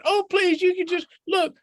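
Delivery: high, pleading little rabbit voice; begging in a high squeaky voice; Rabbit's voice. Acted. Rabbit begs Fox for a fish, high and pleading.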